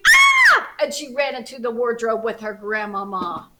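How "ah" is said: put-on high piercing girl's scream; little girl screaming at the wolf. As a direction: shriek in terror. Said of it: "And Little Red screamed".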